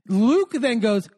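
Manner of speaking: ordinary talk, nothing marked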